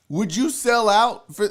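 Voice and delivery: usual speaking voice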